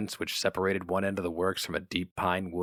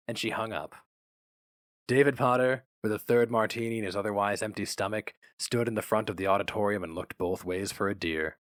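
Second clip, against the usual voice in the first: usual speaking voice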